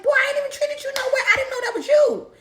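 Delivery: high fast indignant protest. Retold or imitated. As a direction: fire back, offended and quick. Indignant reply to boyfriend.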